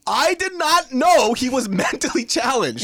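high, slow, laughing through it; laughing disbelief. Real, not acted. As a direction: crack up at the punchline. Laughing at his own shock.

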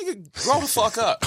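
high, loud, comic telling-off; mock scolding. Mock-scolding child over skinned knee.

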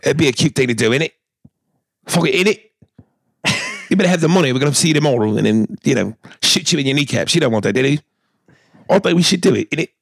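put-on high, playful British gangster accent; mock a London thug who threatens. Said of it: "that accent is anything but".

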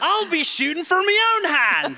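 high, bright Scottish brogue; Scottish accent like Merida. Pretend. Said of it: "mine sounds like Merida from Brave".

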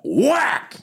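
high yelped whack; whack sound effect. Retold or imitated. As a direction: yelp the hit sharply. Bat hits the comedian.

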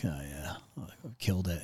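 low, soft mock-cocky aside; quietly self-satisfied, imitating past cockiness; understated, hushed cockiness; a bit cocky. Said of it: "a little bit cocky".

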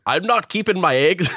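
put-on high-pitched, botched Scottish accent gone Lucky Charms; bad Scottish accent. Retelling what Saoirse said on the call, bad Scottish accent. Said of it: "that was like Lucky Charms".

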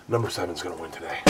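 low, hushed retold tip-off; imitated quiet aside. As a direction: slip it in low and soft, as if confiding. Retold confidential aside from Sammy.

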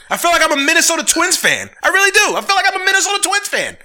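high, loud, fed-up cry; frustrated fan shouting his despair; exasperated shout. Real, not acted. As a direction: throw up your hands and yell it. Ranting about his team.

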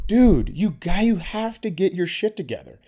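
high, heated telling-off; exasperated scolding. Retold or imitated. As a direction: lecture him at the end of your rope. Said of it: "now I'm losing my shit".

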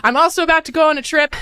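rushed and out of breath. Real, not acted. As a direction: talk fast while out of breath. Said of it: "suddenly I'm out of breath".

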